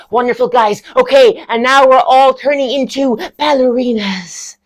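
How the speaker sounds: high, loud rallying drama lecturer; Stevie. Acted. Lecturer turning class into ballerinas, high and effusive. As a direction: sweep the class along grandly. Lecturer announces next exercise.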